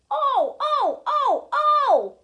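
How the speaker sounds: high squealing cries; screaming trucks. A narrator's reading. Trucks scream as Edward pulls them. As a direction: shriek it like startled trucks. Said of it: "screamed the trucks".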